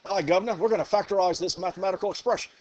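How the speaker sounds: laughing through a fast, hammed-up English accent; a giggly mock English gent, quick. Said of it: "do my impression anyways".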